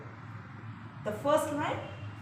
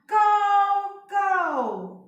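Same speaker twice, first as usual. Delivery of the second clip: rook call; 9 semitones higher, 8 dB louder than usual. Acted. Rooks' call in poem.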